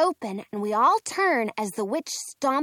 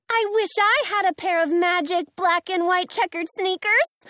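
gleeful yell; 8 semitones higher, 5 dB louder than usual. A narrator's reading. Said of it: "Dorothy yells with a grin".

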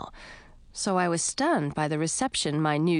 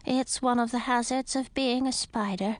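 sad; 6 semitones higher than usual. A narrator's reading. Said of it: "said the voice sadly".

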